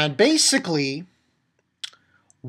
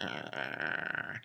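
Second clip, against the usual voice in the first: whispered aside; 13 semitones lower, 15 dB quieter than usual. Retold or imitated. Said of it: "I'm like".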